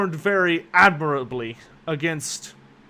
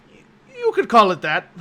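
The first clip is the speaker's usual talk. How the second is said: stammered, choked-up start, a bit louder; nearly choking; 5 semitones higher, 5 dB louder than usual. Acted. Anders sputtering after choking on bread at the question. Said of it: "almost chokes on the piece of bread".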